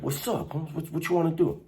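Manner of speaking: high, soft taunting "what you wanna do"; mock tough-guy taunt. Mocking the junior-high move, high taunting voice. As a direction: mimic a cocky kid squaring up. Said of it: "you do that stupid".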